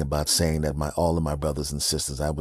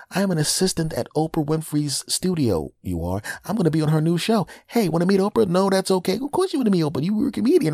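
high-pitched lady voice; new wife chatting, higher; 11 semitones higher than usual. Retold or imitated. New wife telling what she does, high voice. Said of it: "she's gonna go".

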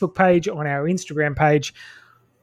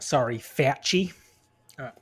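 ordinary talk

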